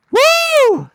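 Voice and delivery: loud, high whoop; excited whoop. Real, not acted. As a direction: let out a joyful cheer. Excited cheer while riding.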